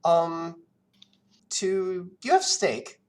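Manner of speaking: put-on high, timid child voice; hopeful kid. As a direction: ask the barman like an eager little boy. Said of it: "and he says".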